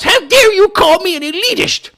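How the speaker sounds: hammed-up high mocking voice of an outraged congresswoman; mock AOC outburst. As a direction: parody her indignant rant in a squeaky voice. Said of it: "She went nuts".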